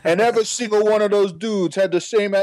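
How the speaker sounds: mock a trash-talking Boston college player's accent; bad Boston accent. Recalling Worcester trash-talkers to his cohosts, bad Boston accent. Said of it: "me doing a bad Boston accent".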